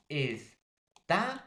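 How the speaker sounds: very slow "estafa", es-TA-fa with rising "ta"; stress on penultimate syllable. Shown as a demonstration. Teacher explaining to students, stressed "ta" in "estafa". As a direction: separate the syllables of "estafa" and punch "ta". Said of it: "la mayor fuerza de voz".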